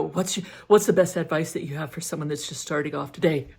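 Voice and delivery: quick interviewer patter, fast; imitating interviewer. Interviewer asking a stranger for advice, brisk and fast. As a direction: rattle off the question like the Instagram guy. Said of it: "Like this".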